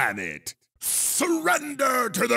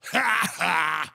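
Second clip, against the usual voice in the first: short, low laugh; low game-character cackle at a rival; gloating laugh; 5 semitones lower than usual. Acted.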